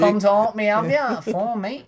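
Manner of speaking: high mocking voice, teasing; put-on high voice taunting. Continuing the mock voice.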